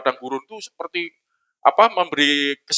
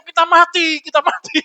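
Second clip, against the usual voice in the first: mock panic; 11 semitones higher than usual. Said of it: "murid-muridnya kan ngomong".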